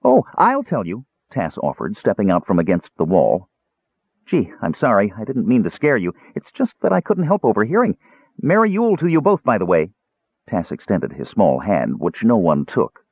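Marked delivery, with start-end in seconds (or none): 0.0-1.0 high-pitched, eager little kender; high character voice, keen to help; helpful kender. A narrator's reading. 4.3-9.9 high-pitched, apologetic kender chatter; high, friendly little character voice; chatty kender. A narrator's reading. Kender's voice in narrated dialogue.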